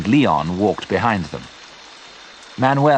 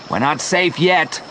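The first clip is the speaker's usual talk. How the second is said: mild shout, higher pitch; 6 semitones higher than usual. A narrator's reading. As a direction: call out a warning, restrained. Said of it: "Joe shouted".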